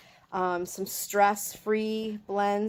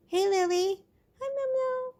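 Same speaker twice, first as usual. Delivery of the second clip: pet-talk calling. Real, not acted. Owner greeting her pregnant cat, high baby-talk coo. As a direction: sweet-talk the cat closer.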